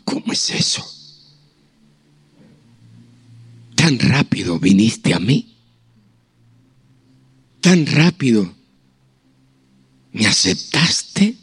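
low, slow, hushed wonder; marvelling at God's quick acceptance, slow and low; awed wonder. Retold or imitated. Awed question to God.

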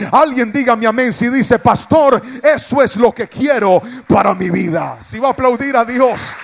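rising, calling for amen; rallying the crowd. Acted. Pastor urging the congregation to applaud, rousing call. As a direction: stir the church to applaud.

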